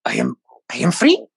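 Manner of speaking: high, wondering prisoner voice; bewildered creature voice. Acted. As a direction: ask it in disbelief.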